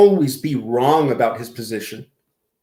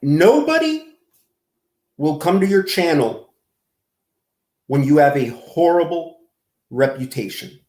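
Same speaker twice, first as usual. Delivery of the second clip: deliberate, emphatic. Real, not acted. Lecturing G-Man about his channel, deliberate broken-up phrasing.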